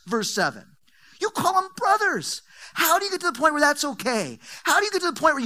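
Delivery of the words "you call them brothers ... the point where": outraged. Acted. Repeated line rising in outrage.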